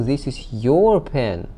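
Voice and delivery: slow, emphatic "your"; stress on YOUR. Shown as a demonstration. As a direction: stretch and lean on "your". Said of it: "it's been put for emphasis".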